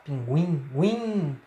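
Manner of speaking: "Pinguim", then "guim" alone, nasal and drawn out; nasal syllable shown. Shown as a demonstration. Teacher showing the sound in another example, nasal "guim". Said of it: "Esse som, guim, guan, guá".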